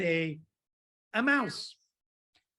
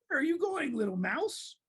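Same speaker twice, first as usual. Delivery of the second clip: high, lilting snake voice; wheedling snake; 7 semitones higher than usual. Acted. Snake calls to the little mouse, high and lilting.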